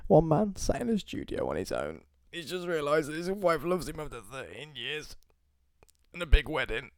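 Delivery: high, soft, wavering pity voice; mock-sad storytelling. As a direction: narrate your own sad tale, hammed-up. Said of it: "It's quite a sad thing, isn't it?".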